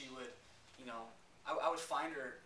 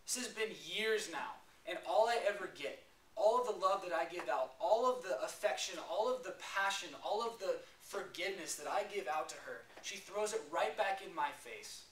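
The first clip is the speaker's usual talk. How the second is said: yelling at God; 7 semitones higher, 7 dB louder than usual. Retold or imitated. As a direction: cry out at heaven in anger, full force. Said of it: "I started yelling at God".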